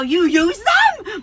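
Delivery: loud, slow, drawn-out eager ask; eager question. Retold or imitated. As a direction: lean in keenly. Eager interest in wipes.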